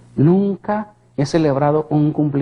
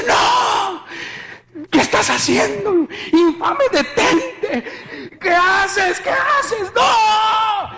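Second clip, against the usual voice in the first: high, screaming horrified protest; terror revivido; much slower than usual. Retold or imitated. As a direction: scream at your attacker to stop. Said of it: "era un temor muy fuerte". (gritos agudos de terror)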